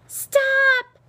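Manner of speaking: high, loud scream of protest; piercing, drawn-out yell. A narrator's reading. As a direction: scream it out at the top of your voice. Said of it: "screamed Dicey".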